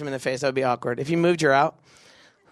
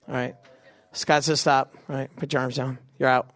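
normal talking voice

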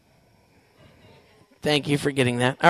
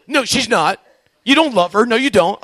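mock objection; 3 semitones higher, 9 dB louder than usual. Imagined objector arguing about Mary.

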